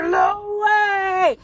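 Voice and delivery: high desperate yell of his name; a strained, far-off cry; retold scream. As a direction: scream the name across the room in panic. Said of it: "we hear Louis screaming".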